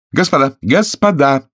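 calling the table to order; shouting. A narrator's reading. Hostess calls the guests to attention, shouting. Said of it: "крикнула".

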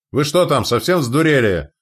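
loud, high, indignant yell; angry shout. A narrator's reading. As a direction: shout furiously at the other ship. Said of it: "прокричал зло Назар".

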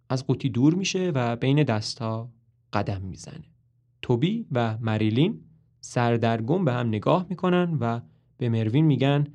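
ordinary delivery

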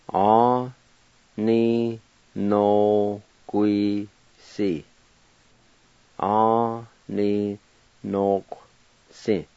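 "Aw, nee, No, kui, si" split into syllables with pauses. Shown as a demonstration. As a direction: spell out "nok" with just a Q sound. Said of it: "pronounce the Q sound".

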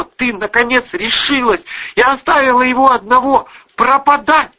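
high, loud angry daughter; accusing. A narrator's reading. Daughter charges mother with abandoning father, angry. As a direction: drive each charge home, pitch held high.

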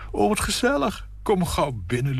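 high, fluttery lady's voice; gushing hostess. Acted. Nina welcoming her friend at the door, gushing. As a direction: coo over the flowers like a sweet hostess.

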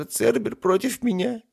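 high, wailing self-pity; sobbed complaint. Acted. Demon rejected even by Cerberus, sobbing. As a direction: whimper it high, betrayed. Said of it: "всхлипнул он".

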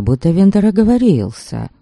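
usual speaking voice

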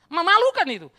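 high, loud command; loud rebuke. Real, not acted. Preacher rebuking the congregation, loud and high. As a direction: fling the rebuke at them.

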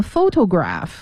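photograph with the first syllable PHO stressed; PHO-to-graph, beat on PHO. Shown as a demonstration. Teacher contrasting it with photographer, photograph stressed on the first syllable. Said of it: "重音在第一个音节fo上面".

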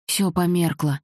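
usual speaking voice

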